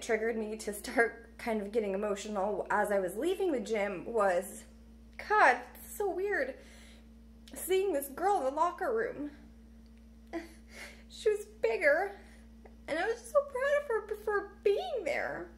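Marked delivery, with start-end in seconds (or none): 5.3-15.5 talking through rising tears; holding back tears. Real, not acted. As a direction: voice pushed up high, close to tears. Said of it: "I get emotional".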